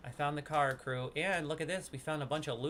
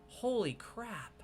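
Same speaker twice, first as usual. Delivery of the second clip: surprised; 9 semitones higher, much slower than usual. Real, not acted. Reacting to game lore.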